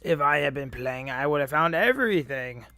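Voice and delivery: put-on loud boastful old-timer voice; Cranky. Player reading the old man's boast, loud character voice. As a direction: brag loudly as a crotchety elder.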